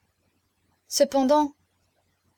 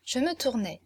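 plain reading voice

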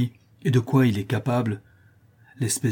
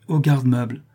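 a curt, defiant answer; 2 semitones higher than usual. A narrator's reading. Stepmother answering the daughter's challenge, defiant. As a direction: toss it back as a challenge. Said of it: "un coup d'œil de défi".

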